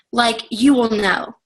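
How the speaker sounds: ordinary talk, nothing marked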